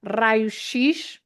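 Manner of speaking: "raio-x" said RYE-oo sheesh, slow with a lifting end; Portuguese pronunciation. Shown as a demonstration. Teacher sounding out "raio-x" for English learners, Portuguese pronunciation. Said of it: "that's the letter X in Portuguese".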